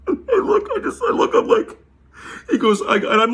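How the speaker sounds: high laugh bursts through the words; cracking up while trying to keep telling it. Real, not acted. Laughing while recalling the absurdity.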